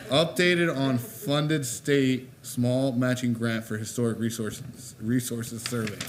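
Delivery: slow, emphatic second attempt at a tricky title; careful tongue-twister retry. Real, not acted. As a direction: pick through a tongue twister word by word, amused. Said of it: "what a tongue twister".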